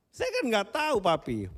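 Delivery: high, hurt daughter's voice; a daughter near tears answering back; tearful daughter. Retold or imitated. Daughter answers scolding father, high and hurt. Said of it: "dia nangis".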